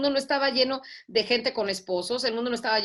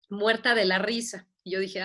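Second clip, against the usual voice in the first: usual speaking voice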